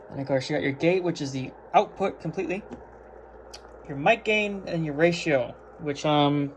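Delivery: normal talking voice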